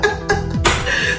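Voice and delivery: high wheezy laugh; real burst of high, breathless laughter. Real, not acted. Vlogger joking that subscribers are family, high laugh.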